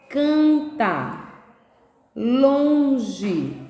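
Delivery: each word split, its nasal first syllable held long; Canta, Longe, Dança: stretch the nasal, pause, finish; syllable demonstration. Shown as a demonstration. Teacher reads example words with N to pupils, syllable-by-syllable demonstration. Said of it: "então eu vou usar o N".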